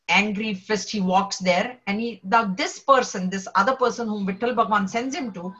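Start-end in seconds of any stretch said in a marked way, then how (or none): none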